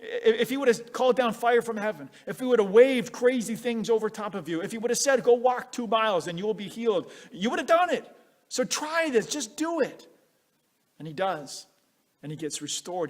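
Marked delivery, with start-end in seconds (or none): 0.0-10.1 pleading servants. Retold or imitated. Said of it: "his servants who remind him".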